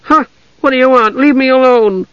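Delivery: put-on high, whiny girl roused from sleep; half-asleep protest. A narrator's reading. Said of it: "more than half asleep".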